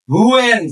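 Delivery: "when" stretched into "hoo-en"; H pronounced. Shown as a demonstration. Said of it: "যদি এইচ এর উচ্চারণ হয়".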